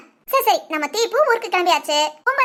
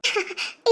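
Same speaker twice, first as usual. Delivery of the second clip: short, soft laugh; small laugh of relief, kept light; relieved little laugh; 6 dB quieter than usual. Acted. Sister hears brother will come along, relieved laugh.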